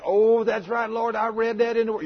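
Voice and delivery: high-pitched dawning recognition; acted sudden realization. Driver answers the Holy Spirit, acted sudden realization.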